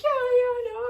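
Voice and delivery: very high, loud brush-off; impatient agreement. Retold or imitated. Traveler brushing off friend's war warning, impatient.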